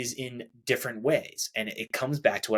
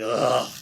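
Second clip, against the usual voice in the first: groan; 4 dB louder than usual. Retold or imitated. Big frustrated sigh in the shower.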